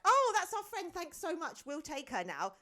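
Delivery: cheerful. Retold or imitated. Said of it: "they were like".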